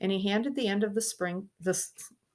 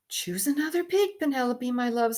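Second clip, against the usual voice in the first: high, louder pleading king voice; king coaxing; 7 semitones higher, 5 dB louder than usual. Acted. King pleading with his daughter, higher and louder. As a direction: sweet-talk a stubborn child.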